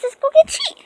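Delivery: high excited dog-calling voice; talking to a dog. Real, not acted. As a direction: coax the dogs with a treat. Calling dogs for a treat.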